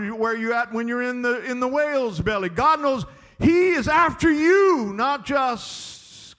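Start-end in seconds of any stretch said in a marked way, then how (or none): 2.5-6.4 high, loud, drawn-out climax; slow down and hammer each word; full-force preaching. Real, not acted. Preacher telling runaways God pursues them, loud climactic preaching.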